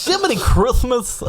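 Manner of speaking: high, slow goofy voice; corny exclamation, high and slow. Pretend. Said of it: "add the lisp in there too".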